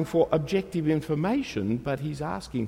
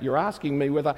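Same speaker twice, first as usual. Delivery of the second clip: ordinary talk, nothing marked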